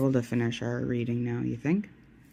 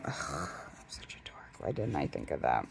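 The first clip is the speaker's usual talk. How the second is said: breathy self-scolding whisper, much softer; 12 semitones lower, 8 dB quieter than usual. Pretend. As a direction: mutter it to yourself like a dork.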